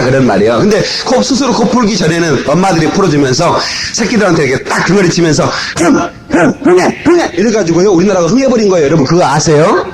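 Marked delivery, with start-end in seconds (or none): 5.8-7.3 higher, rhythmic coaxing; mother coaxing a nose blow. Retold or imitated. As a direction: coax a child to blow, higher. Mother helping child blow nose. (아이 코 풀어주는 엄마처럼 높게 흥흥)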